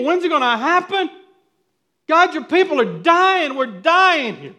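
high, loud desperate pleading to God; prophet crying out. Retold or imitated. Prophet crying out to God for deliverance, loud pleading. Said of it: "the prophet's crying out to God".

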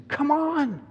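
put-on high-pitched girlish urging; long, high plea; urgent Lucy. A narrator's reading. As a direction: play a child pulling her sister along.

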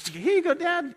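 high-pitched imitation of a teenage son. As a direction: play the son handing Dad a drink. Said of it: "my teenage son to get me".

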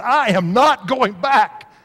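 high-pitched, drawn-out retold refusal; emphatic refusal. Husband retelling his answer to his wife in the car, emphatic refusal. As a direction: dig in, stretching out each word.

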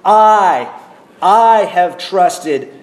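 "I" said twice, loud and slow; emphatic I. Shown as a demonstration. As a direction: stress on I, repeated "I, I". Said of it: "It's emphatic".

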